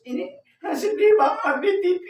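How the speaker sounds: high, weepy imitation of a TV viewer; mock crying. Wife explaining tears over a TV show, mock sobbing. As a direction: whimper it high, hamming up the tears. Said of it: "Kenapa mau nangis?".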